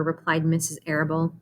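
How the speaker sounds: usual speaking voice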